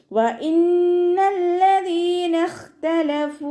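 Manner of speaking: slow, high, melodic tajweed chant; Quran recitation. Shown as a demonstration. Teacher chants the verse for students, slow and melodic. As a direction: draw each syllable out in a sung recitation.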